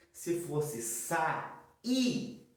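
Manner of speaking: "sair" said very slowly, in two separate syllables; hiatus contrast shown. Shown as a demonstration. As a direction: split "sair" into sa-ir. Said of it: "teria um acento". ("sair" bem devagar, em duas sílabas)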